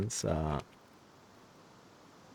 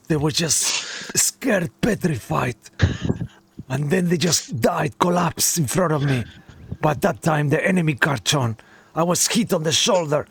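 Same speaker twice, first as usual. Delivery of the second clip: put-on shaken soldier, light Russian accent, higher pitch; half Russian accent; 5 semitones higher than usual. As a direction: recount the horror with a hint of Russian. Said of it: "half Russian accent now".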